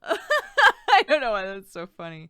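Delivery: slow, laughing aloud. Real, not acted. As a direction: break up laughing. Laughing at a chat joke.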